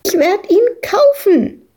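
put-on child shrieking. Madita shrieks back at the farmer, high and loud. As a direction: shriek it back at the old man. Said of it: "kreischte Madita dagegen an".

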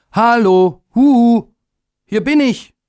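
put-on high, loud, bright child call; small sky-child shouting hello down below; little day calling out. A narrator's reading. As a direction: call out high and eager to be noticed. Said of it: "rief er".

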